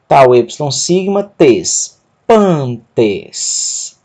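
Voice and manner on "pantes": "pantes" drawn out, weight on "pan"; slow "pantes", stressed on its first syllable. Shown as a demonstration. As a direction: lean on the "pan" of "pantes", then let "tes" trail. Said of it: "Sílaba tônica, pan".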